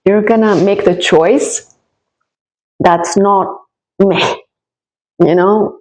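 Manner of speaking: ordinary talk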